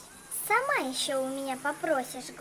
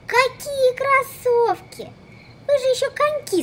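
incredulous; 8 semitones higher, 6 dB louder than usual. Acted. Genie balking at the diva's sneaker wish, incredulous. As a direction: drag it out in disbelief.

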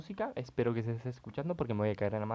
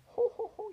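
shrill jolly jo jo jo; high falsetto laugh. Real, not acted. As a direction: cackle with glee in falsetto. Just caught shiny Magikarp. (risa aguda de alegría)

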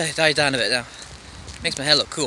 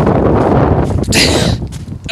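laughing; 6 dB louder than usual. Real, not acted. Laughing in a gust.

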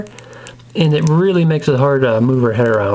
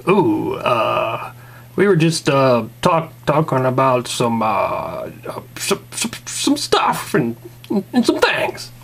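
Rick; 3 semitones lower, slower than usual. Acted. Man caught with Jesse, sheepish stammering. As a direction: stumble and stall, trailing into broken fragments.

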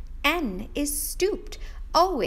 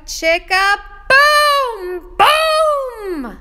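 higher, much louder boom boom; booming refrain; 11 semitones higher, 13 dB louder, much slower than usual. Acted. Teacher booming the refrain for the kids. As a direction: let the booms explode.

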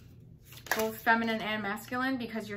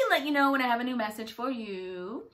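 ordinary talk, nothing marked

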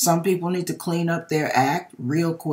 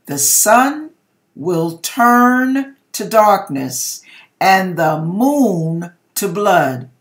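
solemn prophecy; 3 semitones higher, 7 dB louder, slower than usual. Retold or imitated. Voicing Jesus's prophecy, slow, weighty.

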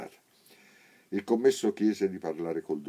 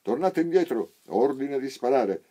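loud, high barked halt; a soldier shouting a warning at the blind; 9 semitones higher, 10 dB louder than usual. A narrator's reading. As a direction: yell the order to stop from the gate. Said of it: "un soldato gridò loro".

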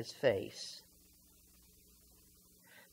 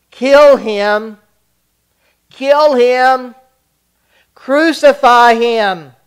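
loud, higher, baying mob yell; shouted back; 8 semitones higher, 13 dB louder, slower than usual. Acted. The crowd answering Pilate, furious shouting. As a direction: roar it again and again for blood. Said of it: "And they shouted back".

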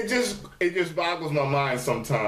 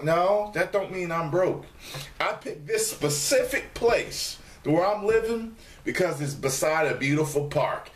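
ordinary talk, nothing marked

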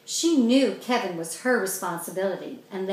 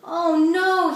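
much higher, louder dismayed moan; 9 semitones higher, 7 dB louder than usual. A narrator's reading. Kevin dreading their mothers, moaning. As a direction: groan at the thought. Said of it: "he moaned".

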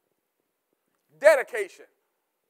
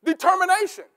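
ordinary talk

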